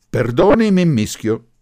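loud, high, combative comeback; quick retort. A narrator's reading. Professor answering the groom's relative, combative retort. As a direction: snap back at once. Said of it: "rimbeccò subito il Gori".

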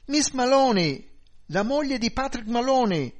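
loud, high-pitched wail of identification; distressed shouting. A narrator's reading. Wife tells police who is calling, shouting in distress. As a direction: cry your name out urgently, stretched and high. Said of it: "gridò".

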